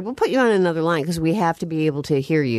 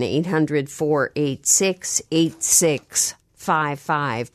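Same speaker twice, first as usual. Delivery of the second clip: slow number read; much slower than usual. Shown as a demonstration. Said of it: "reading out the call-in number".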